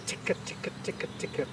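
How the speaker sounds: soft breathy ticker ticker; whispered ticker chatter. Shown as a demonstration. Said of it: "it's ticker".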